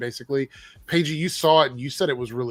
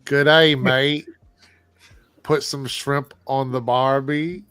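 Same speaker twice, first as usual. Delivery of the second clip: loud, hammed-up Aussie greeting, drawn out; put-on Australian twang, slow and loud; Australian accent; 5 dB louder, much slower than usual. Shown as a demonstration. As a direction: do an overblown, cartoony Australian accent. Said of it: "I do a really good Australian accent".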